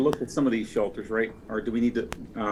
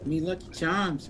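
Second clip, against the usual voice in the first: slow mock Irish accent, pitched up; 5 semitones higher, 6 dB quieter, slower than usual. Player tries the Irish accent for friends, slow and mock. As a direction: joke Irish leprechaun lilt. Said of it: "you're gonna have to do the accent".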